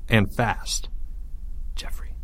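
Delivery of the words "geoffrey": very soft, secretive call; hissed whisper. A narrator's reading. Leo calls Geoffrey while hiding, hissed whisper. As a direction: hiss the name under your breath. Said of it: "Leo hissed".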